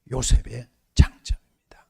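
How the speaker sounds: hushed, breathy, unvoiced; whispered point. Real, not acted. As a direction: let it drop to a whisper. Whispered punchline.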